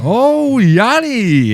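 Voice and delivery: very high, loud, held wail; drawn-out mock cry. Drawn-out mock cry mid-story.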